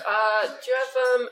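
lightly mocked hesitant ordering; imitated hesitant customer. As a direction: stall on the ums, imitating an indecisive customer. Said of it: "And he'll be like".

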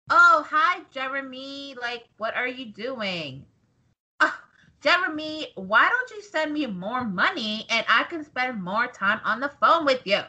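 affected celebrity impression, sweet-talking a caller; Sasha Banks. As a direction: coax the fan in a put-on star voice. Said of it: "my Sasha impression is spot on".

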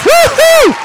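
high, loud woo-hoo; cheering shout. Real, not acted. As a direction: whoop it at the top of your voice. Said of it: "I lost my voice".

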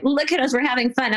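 high, quick imitation of a show-off; mock perky bragging. Mimicking bloggers posing on vacation, mock perky bragging. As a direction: gush it with mock vacation cheer.

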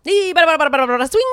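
rapid auctioneer chant, loud and high. Pretend. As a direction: rattle it off like an auctioneer. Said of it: "You should be like an auctioneer".

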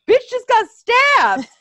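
hammed-up ringside commentator; mock commentator call. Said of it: "Imagine if J R was calling this".

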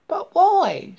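high, loud questioning frog voice; loud, high-pitched frog, questioning; puzzled frog. A narrator's reading.

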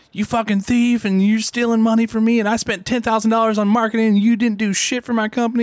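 high, loud mock furious customer; angry customer. Retelling angry callers, a shrill mock furious customer. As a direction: shriek like an irate client.

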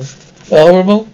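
loud, rising disbelief; can't believe what he heard; shocked. Acted. Dad shocked by friend's answer.

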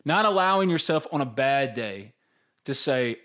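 normal talking voice

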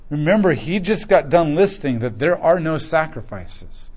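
ordinary delivery

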